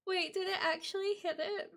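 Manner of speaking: stunned disbelief. Real, not acted. Streamer seeing the follower goal hit, stunned disbelief. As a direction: ask it high and teary, barely believing.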